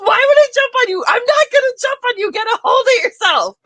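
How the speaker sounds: fast, high exasperated shouting; hammed-up rapid-fire yell; Max Bialystock. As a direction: shout them down in disbelief. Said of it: "Max is just like".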